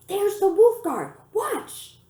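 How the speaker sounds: high, loud and slow, thrilled to spot the heroes; excited cry. Acted. Rena points out the Wolf Guard to her twin, crying out excitedly. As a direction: call it out like a delighted child at a show.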